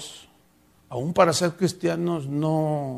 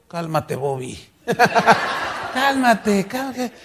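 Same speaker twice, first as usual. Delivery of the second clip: calming him through a laugh; God chuckling at an angry pastor; laughing, gently teasing; amused calming; 4 semitones higher, slower than usual. Retold or imitated. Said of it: "me dice el Señor".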